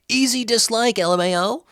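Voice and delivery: high, snide read of a troll comment; mocking commenter voice. Host reading a viewer's dislike comment, snide high sneer.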